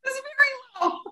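high-pitched amused squeal; laughing agreement. Real, not acted. Laughing agreement with co-host's understatement.

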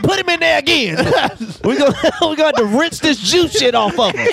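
hammed-up Baptist dad; preacher dad. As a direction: play the exasperated dad through laughter. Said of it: "Dad was like".

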